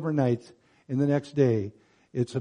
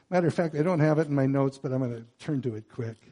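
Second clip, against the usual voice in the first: normal talking voice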